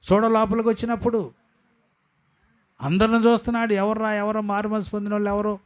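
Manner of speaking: high, ringing sermon voice; fiery preaching. Acted. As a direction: preach it out to the congregation. Preaching the wedding parable.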